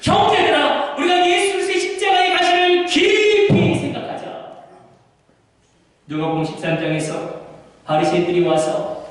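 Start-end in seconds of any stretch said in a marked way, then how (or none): 0.0-4.2 high, shouted exhortation; preaching at full force. Acted. Preacher exhorting the brethren, shouting. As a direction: call the brothers to think deeply.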